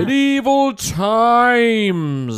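high, loud, slow announcer; dramatic announcer. Acted. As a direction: boom it like a show title.

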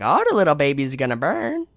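high, loud mock creepy old woman; sing-song menace, swooping up on the words; creepy old lady. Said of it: "That lady's creepy as fuck".

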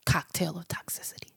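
hushed whisper on the phrase. Real, not acted. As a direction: breathe it out, no voice. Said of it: "you gotta whisper it".

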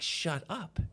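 a hint of a little boy repeating himself; imitated toddler. Father retelling his three-year-old's reply, lightly imitated toddler. Toddler's words voiced in a story.

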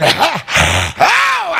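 normal talking voice

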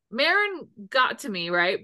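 ordinary talk

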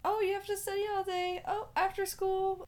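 high, quick parent imitation; nagging parent. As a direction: mimic a parent piling on orders. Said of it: "my parents were like".

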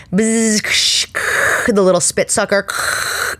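buzzing drill then hissing suction; dental tool noises. Shown as a demonstration. Telling viewers about the dental cleaning, imitated tool noises. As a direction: mimic the drill and spit sucker. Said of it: "So picture it".

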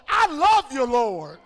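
loud holler, high start falling on Lord; hollered praise. Shown as a demonstration. Preacher leading church to profess love, hollering loudly. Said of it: "somebody holler".

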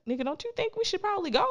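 high, fast, incredulous prodding; mock exasperated urging. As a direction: retell nudging someone who is missing the obvious. Urging oblivious man to leave.

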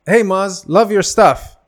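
loud, high fan greeting; calling out. Retold or imitated. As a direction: imitate a fan hailing a comedian. Stranger calls out to comedian.

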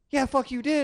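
high-pitched mock angry woman; insistent accuser. Woman insisting the man did it, accusing.

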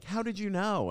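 very high imitated girl voice; high, playful impression of astonished young women; mock amazed girls. Voices the women's surprised question.

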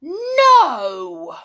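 high, loud, drawn-out cry of refusal; horror. A narrator's reading. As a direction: cry out aghast. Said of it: "said Anne in horror".

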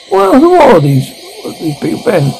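high-pitched bewildered question; startled, high-pitched demand to know; alarmed question. Acted. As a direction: start high in disbelief, then drop. Acted alarm at unknown people present.

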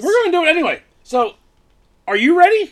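high, loud hype voice; hyped-up call. Acted. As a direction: pump up the crowd, loud and high.

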